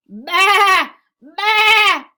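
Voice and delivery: long, high, very loud baas; loud sheep bleat. Acted. Teacher reads the noisy sheep to children, loud high bleating. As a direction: bleat it out at full volume. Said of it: "went the sheep".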